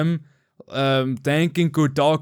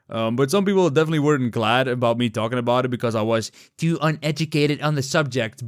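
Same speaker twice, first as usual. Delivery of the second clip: normal talking voice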